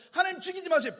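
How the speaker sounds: high, loud pleading cry; loud plea. Acted. Moses crying out to God, loud and pleading. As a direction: beg as if lives depend on it.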